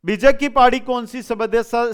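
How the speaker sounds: ordinary delivery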